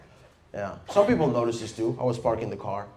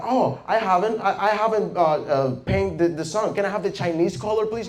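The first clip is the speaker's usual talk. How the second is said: lightly voiced child, higher; little brother; 8 semitones higher, 5 dB louder than usual. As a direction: imitate a kid asking, raised pitch. Acting out little brother drawing.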